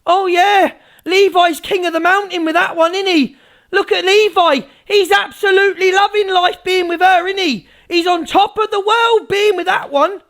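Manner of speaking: high-pitched mocking praise; sarcastic rant. Streamer mocking Levi's love life, sarcastically ranting. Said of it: "sorry, that rant's gone now".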